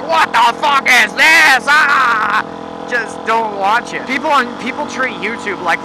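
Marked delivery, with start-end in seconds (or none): 0.0-2.4 slow, put-on baffled whine ending in a groan; mocking a confused viewer. Vlogger mocking viewers who don't get the stream, slow baffled whine. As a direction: play a clueless viewer, drag it out in disbelief. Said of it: "people out there who's like".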